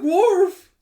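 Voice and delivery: very high, loud mock woof; alarmed dog bark. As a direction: yelp it out like a startled dog.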